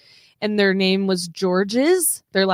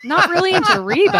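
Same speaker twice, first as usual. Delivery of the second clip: high, loud disbelieving echo; incredulous; 11 semitones higher, 7 dB louder than usual. Retold or imitated. Co-host reacting to a viewer who isn't into [name], incredulous. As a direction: repeat it back in loud mock disbelief.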